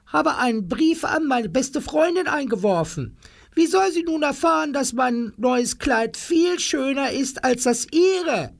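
very high and fast, boastful fuss; vain lady's voice. Acted. Frau Tingel fretting that her friend will miss her boast, very high. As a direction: rattle it off like a vain gossip. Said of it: "schrie Frau Tingel".

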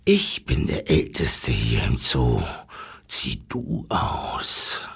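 bare the teeth and growl it low; snarling wolf. A narrator's reading. Old wolf refusing the giraffe, low snarl. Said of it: "fletscht der Wolf".

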